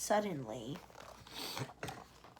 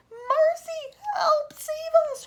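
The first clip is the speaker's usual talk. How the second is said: high, loud, frantic cry for help; ladybird crying out; 10 dB louder, much slower than usual. Acted. Ladybird crying out in terror as the Cloudman comes. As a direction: shriek for mercy in a tiny high voice. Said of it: "cried the ladybird".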